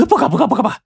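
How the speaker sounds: high-pitched, hurried grovelling; frightened submission. A narrator's reading. Bagou promises the robber he won't tell, frightened. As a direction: gabble it like a terrified underling.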